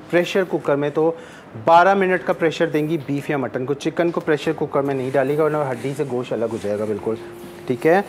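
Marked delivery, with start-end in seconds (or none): none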